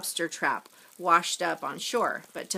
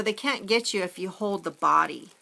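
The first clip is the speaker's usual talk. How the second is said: usual speaking voice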